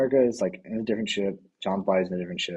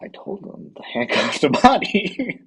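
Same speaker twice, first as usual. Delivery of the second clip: exasperated; 9 semitones higher, 5 dB louder, slower than usual. Retold or imitated. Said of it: "Captain America's just like".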